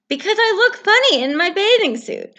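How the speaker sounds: self-conscious Toad; embarrassed Toad. Acted. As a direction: admit it shyly.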